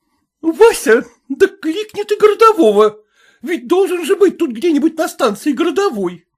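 indignant lady. A narrator's reading. Wife urging her husband to call a policeman, high indignant voice. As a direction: demand the police, outraged.

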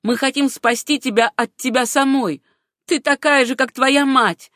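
loud, rising, heated attack; spite and jealousy. A narrator's reading. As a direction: lash out at the rival. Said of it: "совершенно вне себя от злобы и ревности".

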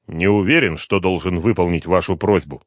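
ordinary delivery, nothing marked

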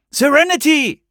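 high, loud call of her name; high-pitched shout to get her attention; boy calling out. A narrator's reading. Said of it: "rief der Junge".

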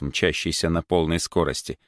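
ordinary delivery, nothing marked

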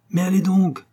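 urgent exhortation. A narrator's reading. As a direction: hurry the stragglers along. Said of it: "Il l'exhortait, il la pressait".

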